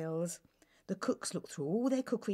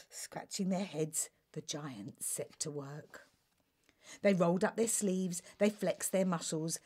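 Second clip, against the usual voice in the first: ordinary delivery, nothing marked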